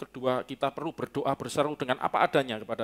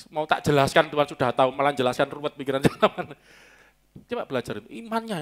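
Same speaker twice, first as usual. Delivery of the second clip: usual speaking voice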